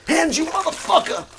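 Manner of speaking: very high shrieked curse; movie yell. Retold or imitated. Reenacting Die Hard, screeching the curse. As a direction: scream the line.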